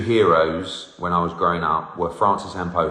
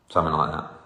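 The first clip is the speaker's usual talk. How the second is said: ordinary delivery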